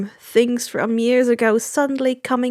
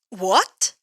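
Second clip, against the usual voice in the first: surprised; 4 semitones lower than usual. Acted. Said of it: "sounding surprised".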